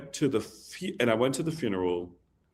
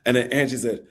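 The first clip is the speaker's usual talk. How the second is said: ordinary talk, nothing marked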